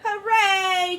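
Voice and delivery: high, loud cheer. Acted. Class cheering the new rule, joyful. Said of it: "cheered the class".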